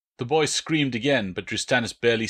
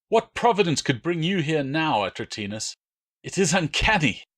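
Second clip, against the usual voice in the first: high, harsh interrogator's voice bearing down; snarling Roman soldier; 6 semitones higher than usual. Acted. Drustanis threatening the captured boy, a high harsh snarl. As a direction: spit it at the captive boy. Drustanis greets Marcus mockingly.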